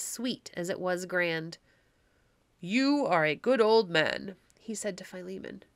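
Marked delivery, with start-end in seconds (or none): 2.6-4.3 slow, grave stranger's voice; a lightly voiced solemn stranger speaking slowly. Stranger blesses old Philemon, slow and grave.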